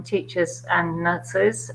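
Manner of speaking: normal talking voice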